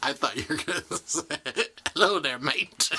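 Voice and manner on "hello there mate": lightly put-on pirate-like mate accent; higher, louder mock seafaring accent; jokey pirate accent through a laugh. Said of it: "That was your only accent you had".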